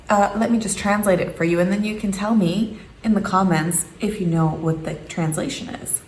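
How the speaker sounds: ordinary talk, nothing marked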